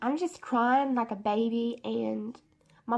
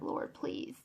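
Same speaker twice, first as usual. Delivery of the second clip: hushed, barely voiced plea to God; retold prayer; 11 dB quieter than usual. Retelling her prayer while lying in pain, hushed praying. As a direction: pray it very softly, as retold. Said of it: "and I'm praying".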